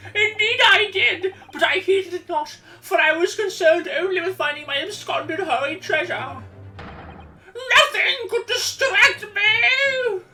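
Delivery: put-on old man voice. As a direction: declare it like a fussy old collector. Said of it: "that old man voice".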